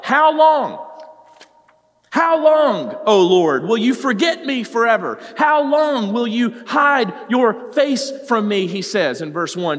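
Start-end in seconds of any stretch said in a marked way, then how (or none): 0.0-8.7 high, loud anguished plea; pleading lament to God, high and loud; crying out to God. Retold or imitated. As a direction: cry the psalm out, drawing it long. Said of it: "David asked God, what?".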